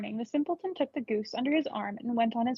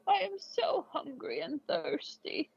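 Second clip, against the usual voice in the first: put-on slow, pleading old man's voice, a bit higher; hungry old man; 3 semitones higher, slower than usual. Little gray man begs the eldest son for food, slow and pleading. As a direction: beg slowly like a starving old man.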